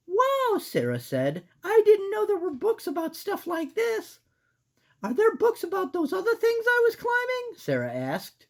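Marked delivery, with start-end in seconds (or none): 0.1-0.6 put-on squeaky girl mouse; high-pitched cartoon mouse. Voicing Sarah. 1.6-7.6 put-on squeaky girl mouse; high-pitched cartoon mouse. Voicing Sarah.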